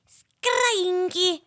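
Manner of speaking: high screechy nonsense noise; random silly sound. Pretend. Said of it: "I'll make a sound".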